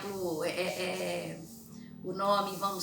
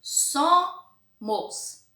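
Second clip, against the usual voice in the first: "Somos" split as SO-mos, first syllable high and loud; "Somos" slowly by syllable, stressed on the first; syllables separated; 8 semitones higher, 7 dB louder than usual. Shown as a demonstration. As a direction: punch and hold "So" in "Somos", then drop "mos". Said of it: "tá separado aqui pra vocês".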